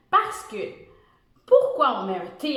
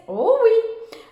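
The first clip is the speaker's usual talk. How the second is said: delighted oh; 11 semitones higher, 7 dB louder than usual. Real, not acted. Teacher's discovery.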